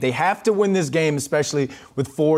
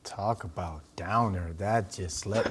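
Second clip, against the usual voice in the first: mock deflated; 6 semitones lower, 7 dB quieter, slower than usual. Co-host deflated by a gloomy pick.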